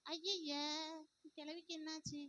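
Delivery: mocking aside. Girl mocking the grandmother under her breath. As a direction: say it so granny won't hear.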